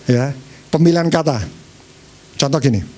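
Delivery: ordinary delivery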